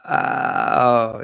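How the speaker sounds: loud, drawn-out mock cooing; charmed listeners. People reacting to Nigel's answer, mock-charmed. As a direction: stretch it out like a tickled admirer. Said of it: "they would be like".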